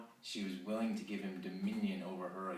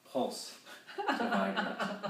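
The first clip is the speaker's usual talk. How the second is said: laughing; 14 semitones higher, 6 dB louder, much slower than usual. Real, not acted. Reader cracks up mid-line.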